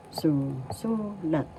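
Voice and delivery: "su, su, lat" in separate syllables, accent on the middle "su"; stress on second su. Shown as a demonstration. Teacher repeating it for learners, "su, su, lat" accented on the second syllable. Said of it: "The accent is in the second syllable".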